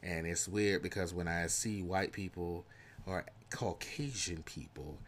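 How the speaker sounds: ordinary delivery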